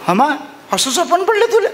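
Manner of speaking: high, put-on old woman voice; old mother. Old mother asks her son about the dream. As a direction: ask in disbelief as the old mother.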